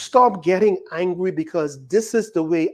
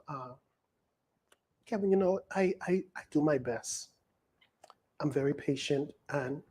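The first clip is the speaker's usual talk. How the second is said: pious calm imitation; 4 semitones lower, 11 dB quieter than usual. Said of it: "very pious and very calm".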